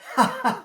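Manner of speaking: very high laughing squeal; shrill triumphant laugh; gleeful squeal. Acted. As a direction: laugh at the fooled master. Bird laughs at the trick.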